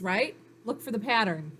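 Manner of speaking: ordinary talk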